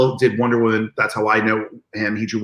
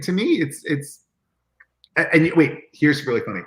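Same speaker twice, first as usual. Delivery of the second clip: ordinary delivery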